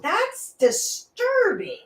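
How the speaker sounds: slow, drawn-out high disgust; mock disturbed. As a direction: stretch it out, creeped out. Mock horror.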